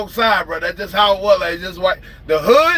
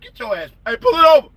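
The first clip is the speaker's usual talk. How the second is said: higher, louder mock police shout; imitated cop yell; 11 semitones higher, 4 dB louder than usual. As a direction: bark it like an angry cop at the car. Imitating police officer yelling.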